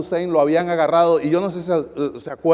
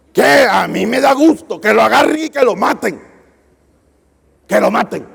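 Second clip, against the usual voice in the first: louder, higher retold outburst; indignant yell, quoting angry brothers; angry shout; 3 semitones higher, 5 dB louder than usual. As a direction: shout it like an offended church brother. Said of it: "varios hermanos se enojaron conmigo".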